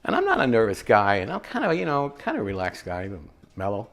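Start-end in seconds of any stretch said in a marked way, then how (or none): none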